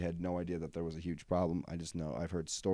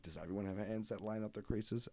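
softer, wondering question with a rising end; 9 dB quieter than usual. Retold or imitated. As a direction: ask it softly like a kid wondering. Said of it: "I'm like".

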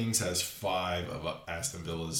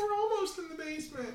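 squeaky high cartoon voice; silly high voice; 3 dB quieter than usual. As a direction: play a squeaky little team mascot. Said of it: "that stupid voice".

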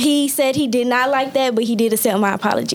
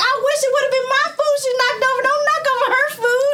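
mock hysterical lady; 12 semitones higher, faster than usual. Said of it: "she was like".

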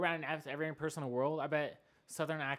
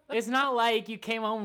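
usual speaking voice

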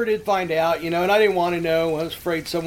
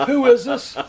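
startled question; 7 semitones higher, 6 dB louder than usual. Retold or imitated. Caught by a woman.

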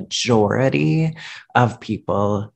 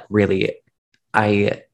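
ordinary talk

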